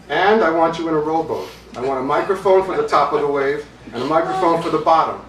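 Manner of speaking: acted eccentric, pressing his request; insistent demand. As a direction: lay out absurd orders in earnest. Eccentric musician piles on demands.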